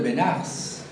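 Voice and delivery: "Benares" cut to be-NAS, no E before the S; pronounced without final E. Shown as a demonstration. Teacher showing what happens without the E, "Benares" as two-syllable be-NAS. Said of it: "Porque si no, tendría que pronunciar".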